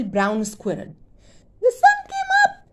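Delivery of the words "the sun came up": squeaky very high chirp. Acted. Happy squirrel chirping at sunrise, squeaky high. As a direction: chirp it with glee. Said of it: "he chirped".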